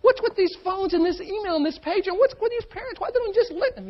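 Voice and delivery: high, loud mocking whine of a fed-up person; whiny complainer. Speaker mimics stressed person complaining about phones and parents, high whiny voice.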